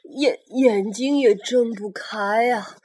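slow, loud, strained cries of pain; hurt dinosaur groaning. Acted. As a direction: stammer it out like a wounded beast. Injured blind T-rex cries out.

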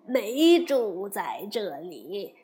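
put-on slow, humble, fawning old god voice; timid local gods. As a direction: plead your innocence slowly to the Great Sage. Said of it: "二神忙说". (土地神缓慢恭敬地辩解)

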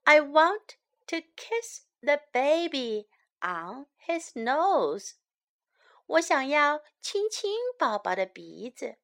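usual speaking voice

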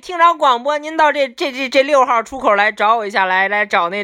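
high, loud Beijing announcer; Beijing accent. Retold or imitated. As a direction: imitate a Beijing station announcement. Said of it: "然后这边就是北京话".

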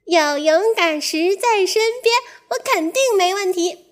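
high, lightly voiced confident mouse; a touch of boastful confidence. Mouse tells the dog he can swim now, mildly confident. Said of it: "小老鼠自信地说".

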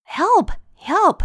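high acted call for help; cry for help. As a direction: play a page calling out for help. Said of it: "cried the page".

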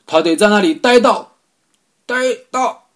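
high, furious sputtering yell; angry shouting. Acted. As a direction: yell it, choking on rage. President rages, sputters.

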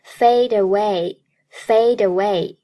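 "fade away" drawn out as "fay-daway"; linked pronunciation. Shown as a demonstration. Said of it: "fade和away连读".

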